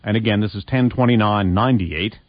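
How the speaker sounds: usual speaking voice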